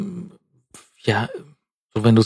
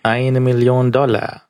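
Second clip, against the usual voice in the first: slow, dry, deadpan villain line; 2 semitones higher, slower than usual. Retold or imitated. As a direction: deliver it bone-dry like a cheap villain. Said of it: "noch trockener".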